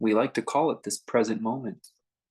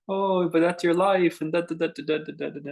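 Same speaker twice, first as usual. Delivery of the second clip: hammed-up romantic lament, fading as it goes; mock melodrama; 6 semitones higher than usual. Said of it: "this big old melodrama".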